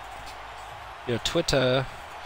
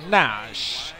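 ordinary talk

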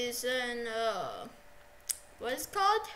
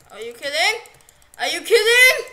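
building from loud to a much higher yell; outraged shout; 4 semitones higher, 16 dB louder than usual. Real, not acted. Gamer yelling at what just happened, rising to a shout. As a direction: blow up in disbelief. Player outraged at game event.

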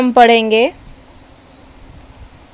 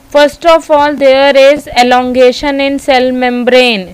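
slow, stretched-out teaching delivery; deliberate classroom dictation; dictating a step; 3 semitones higher, much slower than usual. Real, not acted. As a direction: spell out the step slowly and firmly.

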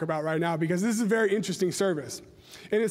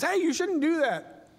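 a bystander's raised, slowed mild warning; mock warning; 8 semitones higher, slower than usual. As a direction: pitch it higher and slower like a passer-by scolding. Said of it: "somebody else comes up and says".

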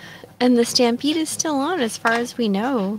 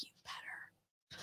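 whisper; 29 dB quieter, slower than usual. Retold or imitated. Whispered quip to stepdad.

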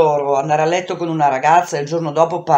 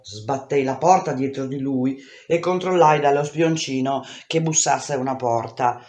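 usual speaking voice

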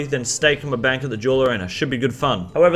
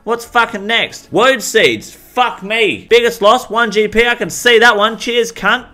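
higher, louder hyped-up host voice; Wade Green persona; 8 semitones higher, 7 dB louder than usual. Acted. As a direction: play the over-excited market reporter.

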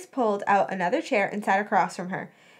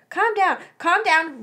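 high, quick "calm down"; flustered repetition; 7 semitones higher, 4 dB louder than usual. A narrator's reading. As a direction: echo the order, agitated and pitched up. Flustered girl repeats the order.

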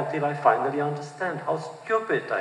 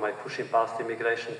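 usual speaking voice

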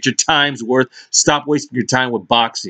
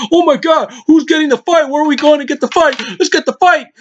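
much higher, squealing mock excitement; mocking hyped fan. Vlogger mocking fans hunting for the big fight, squealing. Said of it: "stupid-ass hyped-up fight".

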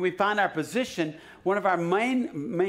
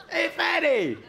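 mock taunt; 11 semitones higher, 3 dB louder, slower than usual. Joking about a king's nickname.

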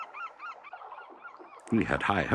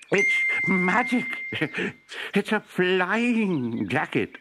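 called out in delight; 13 semitones higher, much slower than usual. Acted. Professor calling to his wife from the air, thrilled. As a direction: call it out across the garden. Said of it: "the professor called".

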